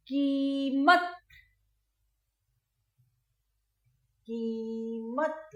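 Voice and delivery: कीमत said very slowly with the ई stretched long; कीमत, slow, long ई held; long ee in keemat. Shown as a demonstration. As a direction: draw out the ई of कीमत for the class. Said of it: "उसके बाद".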